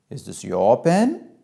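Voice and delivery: put-on slow, pointed questioning of a child; drawn-out disapproving question; disapproving mother. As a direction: query it slowly, showing you disapprove. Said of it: "she has expressed disapproval".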